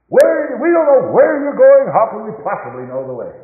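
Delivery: high, loud confused cry of a disciple; Thomas's bewildered plea. Acted. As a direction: blurt it out, lost and pleading. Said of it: "Dear old Thomas".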